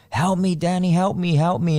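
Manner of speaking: frantic call from the crashed car; panicked plea. Retold or imitated. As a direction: cry for help down the phone. Cousin's panicked phone call.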